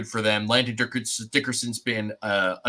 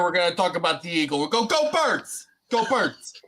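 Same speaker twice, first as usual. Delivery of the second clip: high-pitched, put-on Philly accent; Philadelphia accent; 7 semitones higher than usual. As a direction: ham up a Philadelphia local rallying Eagles fans. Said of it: "What is that accent?".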